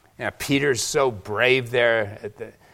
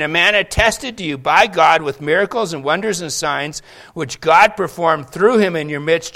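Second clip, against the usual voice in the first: loud, rising sermon delivery; full-force preaching; 3 semitones higher, 5 dB louder than usual. Acted. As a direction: preach it out to the congregation.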